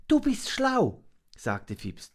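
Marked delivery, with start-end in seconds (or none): 0.1-1.0 very high creature voice; little monkey, admiring. A narrator's reading. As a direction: praise in a tiny high voice.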